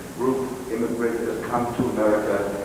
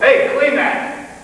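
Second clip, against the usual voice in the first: high, loud, drawn-out command; barked order; 12 semitones higher, 5 dB louder, much slower than usual. Acted. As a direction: shout the order at the children. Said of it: "their children are told".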